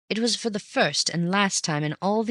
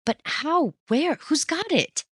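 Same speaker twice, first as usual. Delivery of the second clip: much higher rapid questions; astonished; 12 semitones higher, slower than usual. A narrator's reading. Bunny firing questions at Raffles, high and amazed. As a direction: tumble over the questions.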